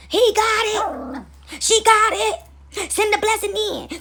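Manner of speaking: high, slow, drawn-out celebration; stretched, jubilant announcement; cheering angels. Acted. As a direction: cry it out high like clapping angels. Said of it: "y'all the angels up there clapping like".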